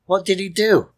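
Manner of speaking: ordinary talk, nothing marked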